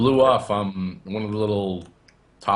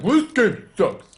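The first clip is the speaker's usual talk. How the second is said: mock whine; 13 semitones higher, 6 dB louder, much slower than usual. Quoting a griping gamer.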